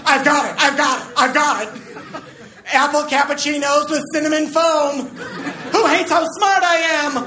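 a prancy coffee inventor thrilled with himself. Acted. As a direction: play the giddy, prancy genius. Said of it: "such an effeminate, prancy accent".

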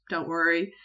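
ordinary talk

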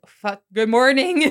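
sudden loud, drawn-out correction; caught-out greeting. Pretend. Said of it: "waking up next to him and being like".

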